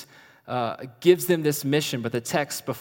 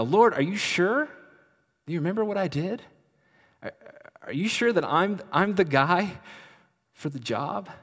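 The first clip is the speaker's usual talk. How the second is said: pleading doubt; 6 semitones higher, 4 dB louder than usual. Acted. Jonah voiced doubting God.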